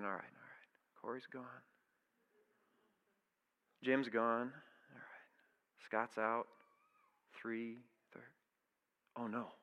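hushed, breathy counting of who's out; whispered inner panic. Retold or imitated. Player on the bus realizing he must start, whispering. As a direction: whisper it to yourself in dawning dread.

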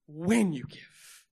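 When stretched out slow and emphatic; stress on When. Acted. Preacher correcting the congregation's assumption, stress on When. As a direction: land heavily on When, taking your time.

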